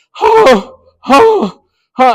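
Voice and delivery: high breathless huffs; upset panting. Retold or imitated. Coach calming down after the call, high panting huffs. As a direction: huff out short gasps of frustration.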